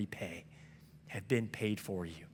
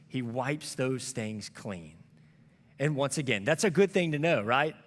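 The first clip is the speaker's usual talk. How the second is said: normal talking voice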